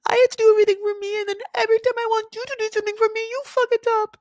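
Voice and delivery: mock the wife whining at her husband; shrill whiny wife. Mocking the wife yelling at her husband in a high whine. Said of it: "She really yells at him".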